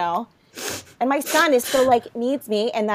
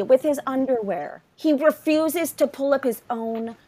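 normal talking voice

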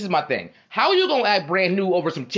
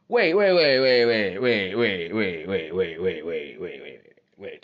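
rapid string of waits sliding steadily down in pitch and fading; acted interruption; 7 semitones lower, much slower than usual. [name] cutting his homie off, mock-stunned interruption.

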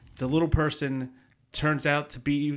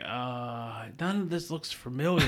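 lightly voiced drunk; 7 dB quieter than usual. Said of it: "he's drunk".

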